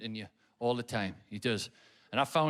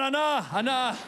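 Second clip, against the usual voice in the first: tearful agreement; 7 semitones higher than usual. Retold or imitated. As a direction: sob out agreement. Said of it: "I went".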